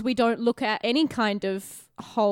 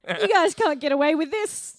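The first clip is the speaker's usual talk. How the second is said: mock cartoon hero; 6 semitones higher than usual. Mock villain defeat line.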